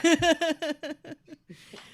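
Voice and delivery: loud, high laughter. Real, not acted. Laughing with cohost.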